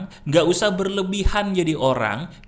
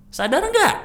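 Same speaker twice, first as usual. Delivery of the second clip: much higher and louder, nggak punched; rising loud jab on nggak; emphatic loud challenge; 13 semitones higher, 5 dB louder than usual. Shown as a demonstration. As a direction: call them out sharply. Repeated emphatic challenge to audience.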